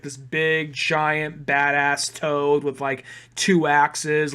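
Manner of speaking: normal talking voice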